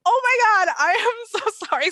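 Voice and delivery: high, loud gushing apology; mortified apology. Retold or imitated. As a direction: blurt it out, acting out your own embarrassment. Mortified apology in retold mix-up.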